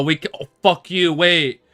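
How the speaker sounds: ordinary talk, nothing marked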